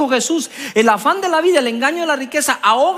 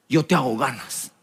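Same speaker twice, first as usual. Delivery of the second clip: lower, softer, amused calm voice of Jesus; a lower, gently teasing reply from above; Jesus answering; 10 semitones lower, 6 dB quieter than usual. Retold or imitated. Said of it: "Jesús se reía". (Jesús respondiendo más grave y suave, divertido)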